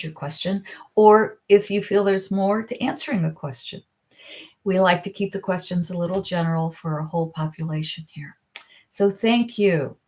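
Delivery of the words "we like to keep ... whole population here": whispered aside. Real, not acted. Quick aside while answering questions.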